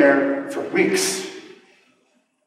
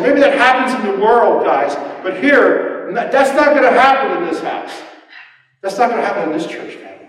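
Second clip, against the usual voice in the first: louder, higher preaching voice; Paul preaching; 6 semitones higher, 3 dB louder than usual. Retold or imitated. Paul telling the church, higher and louder. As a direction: proclaim it like Paul to his church. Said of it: "Paul said".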